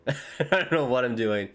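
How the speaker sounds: said through a laugh. Real, not acted. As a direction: let the laugh spill into the words. Repeats the line laughing.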